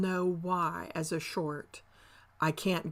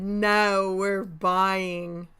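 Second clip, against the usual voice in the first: mock buyers; 3 semitones higher, 8 dB louder, much slower than usual. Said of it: "they stopped back and said".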